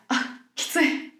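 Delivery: a slow, unvoiced gasp of effort; breathless thought. Climbing a steep hill back home, winded and breathing it out.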